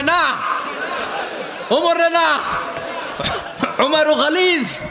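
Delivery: high-pitched wife refusing in alarm; playful high voice pleading no; Aisha's protesting voice. Acted. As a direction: act out a wife's high, drawn-out protest. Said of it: "Ayesha রাদিয়াল্লাহু বললেন".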